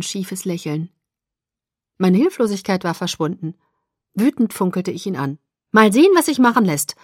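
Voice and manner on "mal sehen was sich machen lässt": higher, louder, indignant retort; angry snapping. A narrator's reading. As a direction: snap it back crossly. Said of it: "fauchte ich". (wütend gefaucht)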